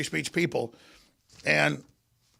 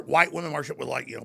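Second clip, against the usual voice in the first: ordinary talk, nothing marked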